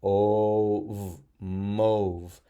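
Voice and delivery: slow o, v, then mauve with a long o; teacher sounding out the rule reading. Shown as a demonstration. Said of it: "O blanky says O".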